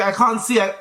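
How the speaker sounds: normal talking voice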